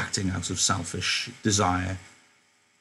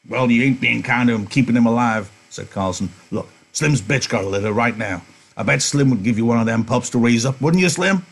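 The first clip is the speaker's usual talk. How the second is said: loud disgusted Carlson; 2 semitones higher, 4 dB louder than usual. Acted. Carlson ordering Candy to remove the stinking dog, loud. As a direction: shove the complaint at him.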